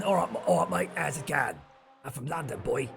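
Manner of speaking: playful put-on Cockney; imitation London accent, having a go; mock London accent. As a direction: do an exaggerated London lad accent. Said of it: "was it London?".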